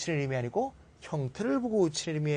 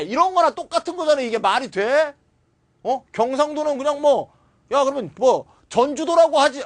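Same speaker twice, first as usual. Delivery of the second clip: exasperated rant; 8 semitones higher, 5 dB louder than usual. Real, not acted. Teacher rants about bad textbooks.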